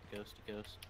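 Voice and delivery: usual speaking voice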